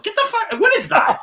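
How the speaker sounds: squeaky high disbelief; mock appalled high-pitched reaction; mock outrage. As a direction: shriek up in fake shock. Outraged reaction to flavorless Italian.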